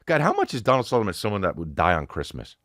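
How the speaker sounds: ordinary delivery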